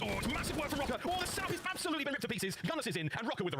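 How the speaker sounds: rising excited shout. Real, not acted. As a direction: caster hype, voice climbing high. Caster reacts to big play.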